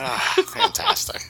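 high sarcastic drawl; mock delight, slowly. Mock reaction to jersey number.

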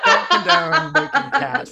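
squealing through laughter, slowed; laughing. Real, not acted. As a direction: laugh out the words in disbelief. Laughing misreading of typo.

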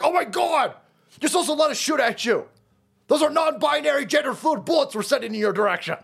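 high, whiny mock protester voice; mocking Antifa. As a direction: sneer it like a hysterical activist. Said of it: "Now Antifa's like".

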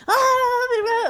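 imitated high, loud panicked "ah"; freaking-out shriek. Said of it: "like, freaking out".

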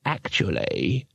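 low, slow tree voice; slow and deep, a tree clearing its throat; talking tree. A narrator's reading. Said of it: "began the tree".

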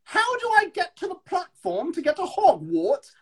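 very high, hammed-up posh British voice; posh RP accent. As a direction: squeal it like a caricature RP tourist. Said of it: "just R P".

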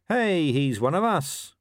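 imagined wolf. Pretend. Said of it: "imagine the wolves saying".

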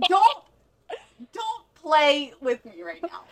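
high, loud, slowing for emphasis; retold warning. Girlfriend replaying her warning to lying boyfriend, indignant.

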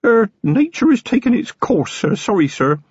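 high, embarrassed little voice; damp little voice. A narrator's reading. Feeney to Vimes as nature takes its course, sheepish. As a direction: apologise meekly, pitched well up. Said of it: "in a damp little voice".

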